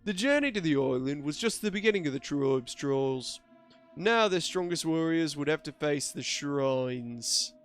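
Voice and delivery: theatrical lore narrator in a put-on accent; dramatic, drawn-out story reading; storyteller narration. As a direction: narrate an epic legend with stretched vowels. Reads game lore as a narrator.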